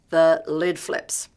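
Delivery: a touch of mock drama; emphatic punchline. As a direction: land it like the blow-up itself. Colleagues describe her temper.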